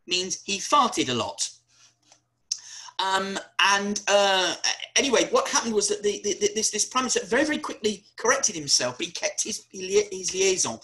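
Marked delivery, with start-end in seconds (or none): none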